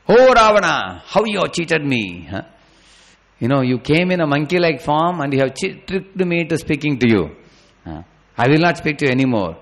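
high, loud, frantic cry at a deceiver; frantic accusing shout. Acted. Sita shouting at the monkey she thinks is Ravana, frantic and accusing. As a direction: hurl the accusation, betrayed and furious. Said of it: "got into transcendental madness".

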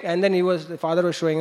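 ordinary talk

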